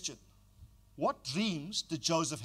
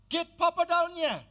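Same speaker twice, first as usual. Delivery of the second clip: urgent call; 11 semitones higher, 5 dB louder than usual. Acted. Joseph summons father, acted.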